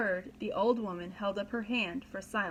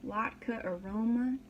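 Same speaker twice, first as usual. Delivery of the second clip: ordinary delivery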